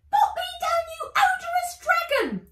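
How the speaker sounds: very high, loud elf scream. Acted. Elf caught by the dragon, screaming high and loud. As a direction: shriek it, wriggling to get free. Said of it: "screamed the elf".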